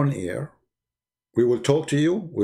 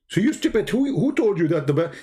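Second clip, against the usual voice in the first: mocking retort; 6 semitones higher than usual.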